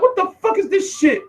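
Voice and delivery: high, baffled outburst; can't believe the message; stunned disbelief. Retold or imitated. Reading a strange direct message, stunned. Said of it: "I'm like".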